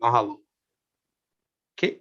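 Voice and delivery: usual speaking voice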